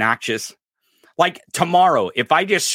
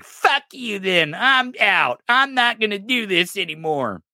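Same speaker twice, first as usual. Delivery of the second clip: mock lawyer quitting; 8 semitones higher, slower than usual. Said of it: "And then Jim Trusty's like".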